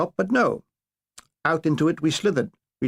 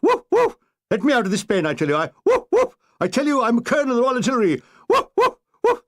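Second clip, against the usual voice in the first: high yelping woofs between fast indignant protests; barking colonel; 7 semitones higher, 3 dB louder than usual. Acted. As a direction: yap like a dog, then bluster. Colonel barking in dog pen.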